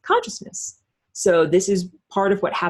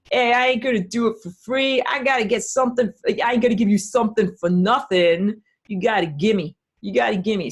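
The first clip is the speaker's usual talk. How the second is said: tough guy; 4 semitones higher than usual. Retold or imitated. Acted quid pro quo tough talk.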